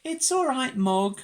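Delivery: put-on high child-like soothing; reassuring Debbie. A narrator's reading. Debbie calms Mog at the vet's, high reassurance.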